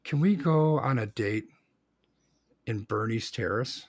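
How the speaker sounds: woo her gently over dinner plans; tender romantic ask. Acted. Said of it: "and you say to her".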